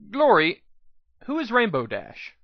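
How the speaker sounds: high call, then pointed question. A narrator's reading. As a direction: call her name, then ask. Calling then asking.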